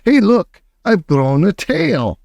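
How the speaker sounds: startled girl, lightly voiced; mock yell. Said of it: "She yells".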